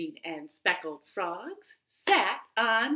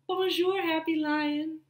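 high, bright sing-song bonjour; cheerful greeting; 8 semitones higher than usual. Acted. Zookeeper's son greets the lion in a high cheery voice.

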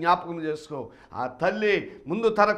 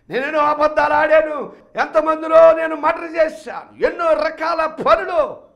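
higher, louder repentant cry; loud confession; 10 semitones higher, 12 dB louder than usual. Acted. Son confessing before God, loud preached cry. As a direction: confess every sin at full voice.

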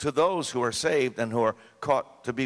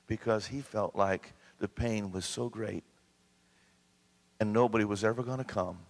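ordinary talk, nothing marked

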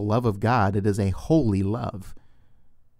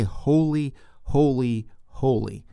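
each "holy" stressed and set apart, the first one highest; measured threefold "holy" for emphasis; emphatic repetition; 3 semitones higher, slower than usual. Retold or imitated. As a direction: punch "holy" three times, stepping down. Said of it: "that's put there for emphasis".

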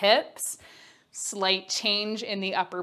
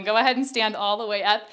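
ordinary talk, nothing marked

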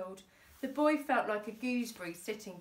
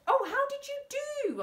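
eager; 6 dB louder than usual. Acted. Vicar eager question.

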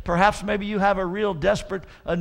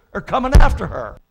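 loud warning; 3 semitones higher, 10 dB louder than usual. Acted. Preacher repeats warning about creditors.

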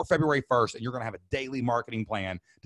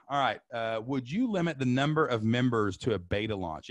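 usual speaking voice

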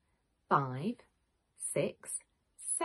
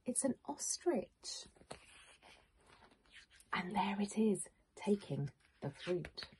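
hushed whisper; 4 dB quieter than usual. Acted. Hushed reveal of animal thief.

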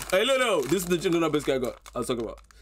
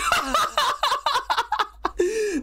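big laugh. Real, not acted. Laughing at a bar.

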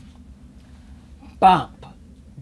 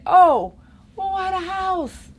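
ordinary delivery

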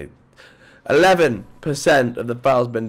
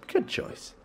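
whispered, softer good choice; 12 dB quieter than usual. Shown as a demonstration. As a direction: breathe it out in a sly whisper. Repeats tease unvoiced.

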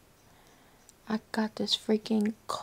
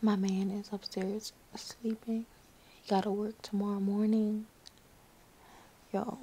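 usual speaking voice

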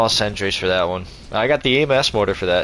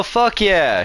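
excited cheer; 9 semitones higher than usual. Real, not acted. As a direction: cheer it out big. Cheering a member.

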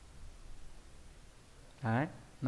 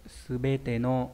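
very slow model word; much slower than usual. Shown as a demonstration. Teacher saying subete no very slowly for students. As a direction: say subete no very slowly, each syllable apart. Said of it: "Bằng với lại".

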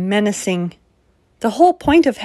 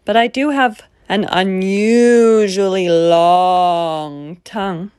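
slurping monster; 2 semitones lower, 4 dB louder, slower than usual. Acted. Monster trying to be menacing.

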